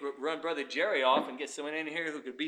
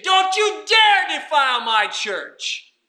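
high, slow indignant shout; fierce indignation; 10 semitones higher, 10 dB louder, much slower than usual. Acted. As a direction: thunder a stern warning, stretching the words. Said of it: "Fierceness of indignation".

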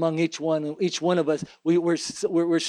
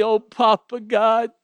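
high, louder, slow cry of grateful joy; overjoyed thanks; 6 semitones higher, 5 dB louder, slower than usual. Acted. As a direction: cry out in joyful relief. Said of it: "and you say".

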